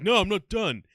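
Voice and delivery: loud, high mock protest; defiant retort. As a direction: imitate a stubborn friend calling back. Friend refuses to stop, retold.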